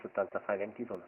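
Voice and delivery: usual speaking voice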